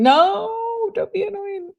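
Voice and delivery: very high, held-out "no"; mock protest. Mock protest at a viewer's comment.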